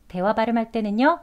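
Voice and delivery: ordinary talk, nothing marked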